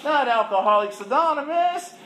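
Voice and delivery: high, loud mock whine; whiny protest. To the counselor, whining about going back to meetings. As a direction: complain like a sulky kid. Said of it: "she said".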